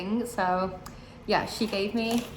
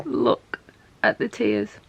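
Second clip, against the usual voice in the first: whispered, holding back tears; real teary hush; tearful whisper; 2 semitones higher, 3 dB louder, slower than usual. Real, not acted. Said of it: "I'm trying not to cry".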